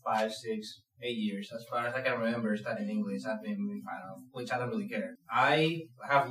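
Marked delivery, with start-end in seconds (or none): none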